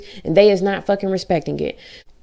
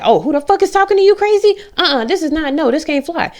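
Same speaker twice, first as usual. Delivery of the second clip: high, loud, indignant outburst; fly to his defence with a shocked, loud outrage; outraged; 9 semitones higher, 3 dB louder than usual. Retold or imitated. Retelling her reaction to the coworker's call, a high indignant outburst. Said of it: "And I was like".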